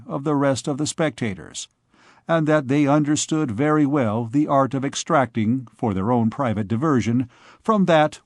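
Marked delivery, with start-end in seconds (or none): none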